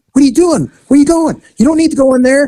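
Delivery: high, insistent imitation of a child; protesting little girl. Little girl tails officer toward the bedroom, protesting.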